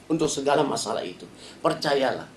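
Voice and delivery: normal talking voice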